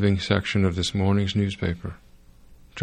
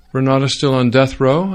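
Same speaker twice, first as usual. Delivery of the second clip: cheerfully; 5 semitones higher, 7 dB louder than usual. A narrator's reading. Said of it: "I said cheerfully".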